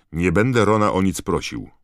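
a stubborn, flat-out no; firmly. A narrator's reading. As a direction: plant your feet and refuse. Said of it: "oświadczył stanowczo Harry".

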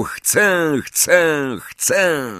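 high, repeated echo call; a chorus echoing round a hall; echoing shout. A narrator's reading. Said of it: "echo zwielokrotniło jego głos".

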